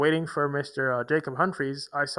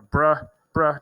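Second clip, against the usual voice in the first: imitating slang bruh; 10 dB louder than usual. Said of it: "I don't talk like that".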